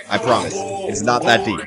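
normal talking voice

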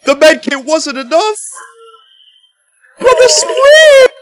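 loud, high-pitched outcry; anguished yell. Real, not acted. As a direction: wail it in loud despair. Losing despite the medkit.

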